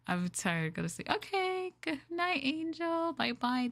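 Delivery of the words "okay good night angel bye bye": high, sing-song farewell; bright goodbye. Real, not acted. Streamer saying goodnight to viewer Angel, high and sing-song. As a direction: wave a viewer off, pitched high.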